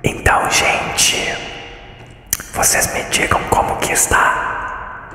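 whispered all through, no voice at all; ASMR whisper. Pretend. Streamer hosting a cave ASMR for viewers, whispering. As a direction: whisper to the audience like an ASMR host. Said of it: "A S M R na caverna".

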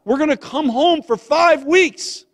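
loud, high, excited news; excited announcement. Retold or imitated. Son's letter home announcing a visit, excited. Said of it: "we were so excited when we got a letter".

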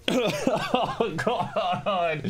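high startled cry breaking up; scared and coughing. Real, not acted. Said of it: "I'm coughing and scared".

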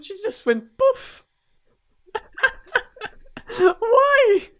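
very high and squeaky, breaking into laughter; laughing disbelief. Real, not acted. She just went poof, squeaky laughing disbelief. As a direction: giggle it out, helplessly amused. Reacting to bride exploding again.

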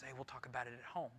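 soft and quick, brushing the question off; hushed quick reply. Pretend. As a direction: hush her gently and fast. Husband hushing wife in church.

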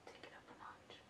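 hushed whisper to the camera. Real, not acted. Whispering to viewers before the prank. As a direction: whisper so he can't hear.

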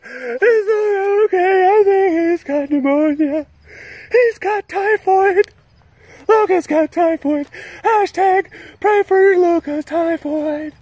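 very high, slow panicked cries; panicked viewer. Retold or imitated. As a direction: shriek it like a frantic worried fan. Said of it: "let's say that Luca sneezes".

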